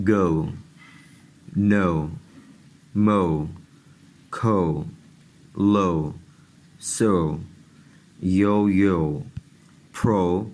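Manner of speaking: each word said alone with a long o, a pause between; teacher reading English o words; word list demo. Shown as a demonstration. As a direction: read the examples one at a time, the o carefully stretched. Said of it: "اب آپ مثالیں دیکھیں".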